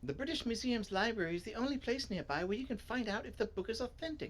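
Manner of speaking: high know-it-all explainer voice; eager story character. Acted. Reader as a character explaining the library, high voice. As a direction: start high and keen, easing down.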